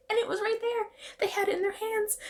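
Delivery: high, soft, incredulous; exasperated disbelief. Real, not acted. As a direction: squeak it out in disbelief. Exasperated about the locket.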